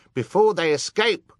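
very high, loud urgent cry; urging. A narrator's reading. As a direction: spur the crowd to give chase. Excited guest urging the crowd on.

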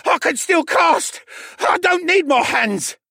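high, loud, slow defiant cry; defiant shout. Acted. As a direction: shout it through the pain. Wounded mage shouts defiance.

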